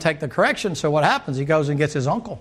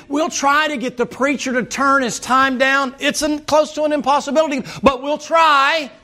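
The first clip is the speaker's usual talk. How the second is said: impassioned preaching; 10 semitones higher, 4 dB louder than usual. Real, not acted. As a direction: preach it high, piling up promise after promise. Preaches, mimicking eager-to-please church.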